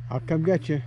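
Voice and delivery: normal talking voice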